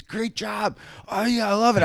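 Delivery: high-pitched gushing approval; delighted director. Retold or imitated. Director loving the extras' chaos, delighted. Director praising, acted quote.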